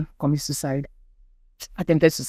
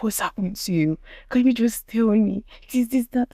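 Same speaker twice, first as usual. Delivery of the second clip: higher, pleading mother, lightly voiced; tearful mom; 7 semitones higher than usual. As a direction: plead with her child. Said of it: "she started crying on the phone".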